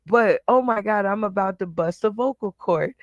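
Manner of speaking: ordinary delivery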